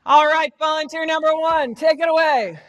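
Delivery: projected emcee call. Real, not acted. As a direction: summon volunteers across the room. Calls volunteers up from audience.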